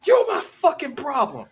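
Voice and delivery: high-pitched mock angry outburst; heated retold confrontation. Retelling a movie character's confrontation, mock angry. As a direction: throw it back as a challenge.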